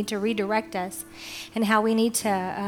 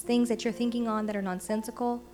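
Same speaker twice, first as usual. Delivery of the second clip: prayer; 8 dB quieter than usual. Acted. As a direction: pray quietly over music. Opening prayer over music.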